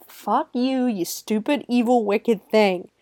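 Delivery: normal talking voice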